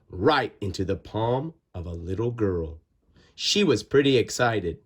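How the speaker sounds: ordinary delivery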